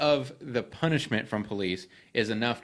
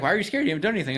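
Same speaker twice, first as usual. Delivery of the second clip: higher, louder, incredulous mock girlfriend; 7 semitones higher, 3 dB louder, much faster than usual. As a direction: imitate her disbelief, pitched up. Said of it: "And she's like".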